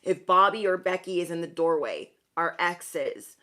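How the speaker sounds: ordinary talk, nothing marked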